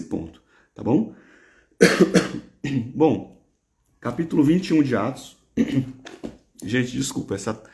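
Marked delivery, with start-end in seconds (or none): 1.8-2.4 short unvoiced cough; one dry cough into the mic. Real, not acted. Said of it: "essa tosse vai ficar ruim mesmo". 5.6-6.3 quick unvoiced dry cough. Real, not acted. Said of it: "essa tosse vai ficar ruim mesmo".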